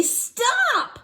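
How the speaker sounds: put-on high shrill child scream; girl yelling as she is lifted; loud yell. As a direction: shout stop at full voice. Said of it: "Taya yelled very loudly".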